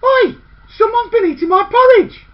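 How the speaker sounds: loud, high outraged cry; indignant shout. A narrator's reading. Eldest girl finding her porridge gone, shouting indignantly. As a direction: bellow it at the empty bowl. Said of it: "the eldest girl said".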